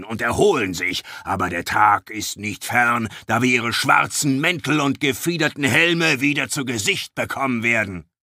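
a gruff dwarf, defiant and warning. Acted. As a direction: rouse the crowd like a grim dwarf. Dwarf Sheldon Skaggs speaks. (grimmiger Zwerg, trotzig und warnend)

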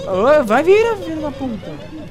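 sudden high scared shout; panicked yell. Real, not acted. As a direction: yelp at the monster in fright. Scared gamer yelling at monster.

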